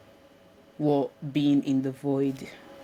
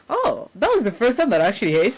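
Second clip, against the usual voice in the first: Italian accent; 8 semitones higher, 8 dB louder, faster than usual. Shown as a demonstration. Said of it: "I can do the accent".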